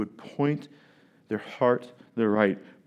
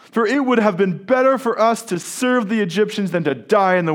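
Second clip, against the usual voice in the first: complaining Israelites; 9 semitones higher, 7 dB louder than usual. Acted.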